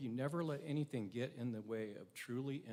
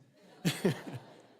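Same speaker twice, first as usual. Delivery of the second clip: short laugh; 4 semitones higher, 9 dB louder than usual. Real, not acted. Surgeon's joke relieved tension.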